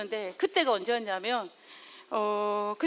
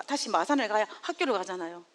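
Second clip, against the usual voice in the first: ordinary talk, nothing marked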